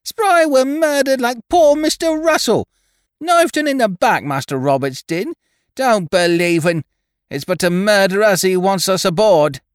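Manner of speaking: high-pitched, loud rustic screech of accusation. A narrator's reading. Young Dickon warns his mates against boarding, screeching. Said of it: "screeched young Dickon".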